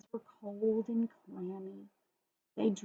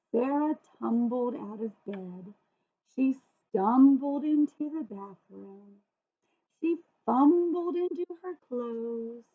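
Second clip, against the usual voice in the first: slow, tumbling storytelling; slow clumsy reading; slower than usual. Acted. Reader acting out a clumsy morning, slow tumbling read. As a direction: read it slowly, stumbling about. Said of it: "the way the author read the words".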